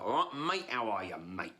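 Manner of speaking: chummy Cockney greeting; Cockney accent. Acted. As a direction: put on a matey Cockney accent. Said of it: "speak with a Cockney accent".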